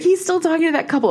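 high, loud squeaky report; excited mom, squealing the news; amazed. Retold or imitated. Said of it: "she's like".

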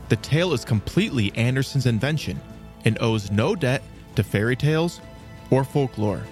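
usual speaking voice

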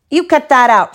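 sharp rising yell at his sister; shouted. Acted. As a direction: bark it out like an annoyed big brother. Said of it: "shouted Brother".

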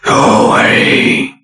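slow, raspy ghostly whisper; frightening harsh whisper. A narrator's reading. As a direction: breathe it at her face like a menace. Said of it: "harsh whisper".

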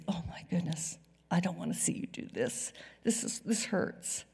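hushed and hurting, mostly breathed; pained whisper. Acted. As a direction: whisper it like a grieving father. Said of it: "That's how God feels".

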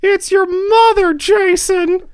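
high, far-off disappointed mother; mom voice. Retold or imitated. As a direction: scold him like his mom. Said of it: "I have someone with a pretty strong opinion".

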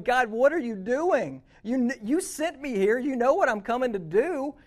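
loud, high, anguished retold plea; imitating a desperate cry to heaven; crying out to God. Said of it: "She's crying out to God".

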